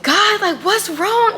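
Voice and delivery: high, loud mock cry out to God; reliving the moment she yelled at God; retold cry to God. Retelling how she cried out to God, high and loud. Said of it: "screaming at him".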